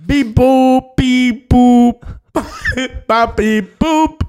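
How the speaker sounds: high, loud beep-boop babble; robot gibberish. Pretend. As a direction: chirp nonsense like a cheerful droid. Cashier speaks made-up language.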